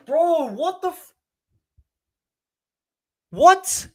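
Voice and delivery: high, drawn-out cry of disbelief; can't believe what he's seeing; stunned disbelief. Real, not acted. Fan watching the VAR call, stunned high disbelief.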